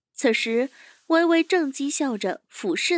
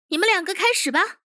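higher, quick commanding character voice; fast higher-voiced command; chief giving orders; 8 semitones higher, 3 dB louder, faster than usual. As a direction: order your men to begin, briskly. Chief orders his two men.

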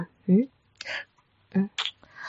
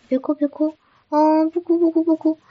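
higher-pitched, repeated hushing; soothing; 7 semitones higher, 3 dB louder than usual. Acted. Girlfriend to crying partner while wiping tears, soothing. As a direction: comfort someone in tears.

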